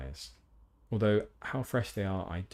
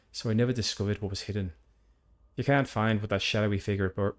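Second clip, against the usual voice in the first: louder, hearty Northern Irish farmer; Northern Irish accent; 2 semitones higher, 5 dB louder than usual. Acted. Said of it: "let's continue with this one for now".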